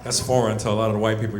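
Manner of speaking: ordinary talk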